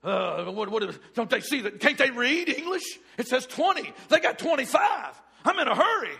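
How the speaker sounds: loud, high exasperated ranting; angry rant. Acted. Acted rant about twenty-five items.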